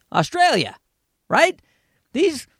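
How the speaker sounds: ordinary talk, nothing marked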